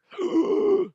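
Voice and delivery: sudden high choking gasp; sharp gasp. Real, not acted. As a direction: gasp as if something flew in your throat. Choked on a bug mid-show.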